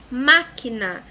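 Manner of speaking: máquina loud, MÁ punched, then quina; stress on first syllable. Shown as a demonstration. Teacher giving an example, máquina stressed loudly on má. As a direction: hit the má of máquina hard. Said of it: "é a terceira sílaba".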